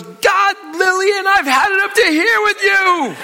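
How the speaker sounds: high, full-volume exasperated yell; very loud, high comic tirade; imitated husband's scream. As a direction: scream it like a husband at the end of his rope. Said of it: "And he starts screaming at her".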